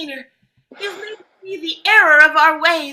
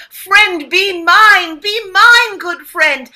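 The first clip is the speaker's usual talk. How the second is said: louder, higher pleading; pleading call; 4 semitones higher, 7 dB louder, much slower than usual. Acted. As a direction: beg loudly for friendship. Quoting a friend request, pleading.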